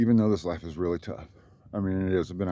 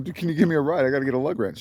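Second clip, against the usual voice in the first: higher, louder hurried ask; urgent plea; 6 semitones higher, 3 dB louder, faster than usual. Retold or imitated. To the kind neighbor, begging a ride, urgent. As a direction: flag her down, urgent. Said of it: "I'm like".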